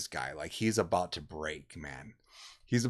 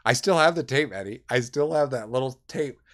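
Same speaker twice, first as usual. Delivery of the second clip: ordinary delivery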